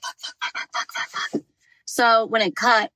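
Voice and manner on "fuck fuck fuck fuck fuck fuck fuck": soft, breathy string of swears under the breath; panicking quietly to himself; hushed repeated cursing; whispered curses. Retold or imitated. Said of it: "And I'm like".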